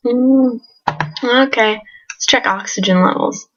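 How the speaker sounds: usual speaking voice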